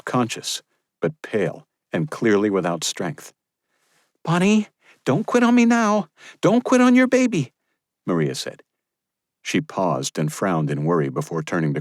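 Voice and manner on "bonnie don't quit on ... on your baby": higher, louder midwife urging her to stay awake; urgent midwife. Acted. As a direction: keep the mother awake, pleading. Maria voiced by narrator.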